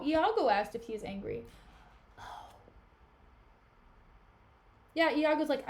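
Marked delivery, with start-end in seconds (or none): none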